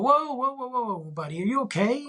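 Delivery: high-pitched string of whoas into a check-in; concerned dad. Retold or imitated. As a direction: rein him in with concern. Dad checking on son about to freak.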